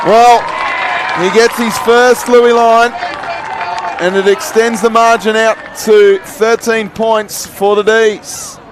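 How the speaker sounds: rising to a loud, high shout as the goal goes in; a commentator erupting for a brilliant goal; excited goal call. Real, not acted. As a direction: call the goal at full voice, thrilled.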